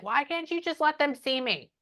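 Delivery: high-pitched exasperated mock yell; relayed outburst, pitched well up without full shouting; frustrated mock yelling. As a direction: imitate someone crying out in frustration, voice lifted. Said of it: "she's yelling at everybody".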